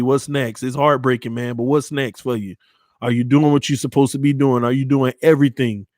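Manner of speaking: ordinary delivery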